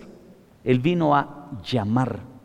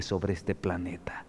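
whisper; 10 semitones lower, 14 dB quieter than usual. Real, not acted. Whispered end of line.

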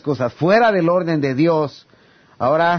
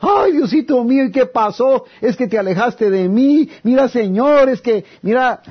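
high, loud, whining plea to God; mock tearful plea; 7 semitones higher, 3 dB louder than usual. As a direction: imitate a believer crying out to God in distress. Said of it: "ahí sí empezamos a llorar".

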